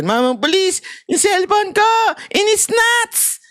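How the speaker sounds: climbing high, drawn-out complaint to the cop; whining plea. Retold or imitated. Robbed citizen begging a policeman, rising high whine. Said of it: "sasabihin natin".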